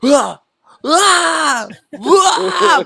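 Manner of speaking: rising high cries of panic; hammed-up screaming at the threat; mock panicked cries. Mimicking panicked screaming victims.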